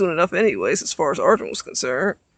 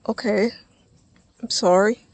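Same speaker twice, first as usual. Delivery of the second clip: ordinary delivery, nothing marked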